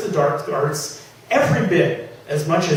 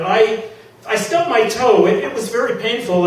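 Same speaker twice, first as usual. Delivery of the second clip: louder, higher, stumbling excuse; flustered shouted excuse; 6 semitones higher, 4 dB louder than usual. Acted. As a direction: shout a lame excuse to the neighbour. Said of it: "he shouted".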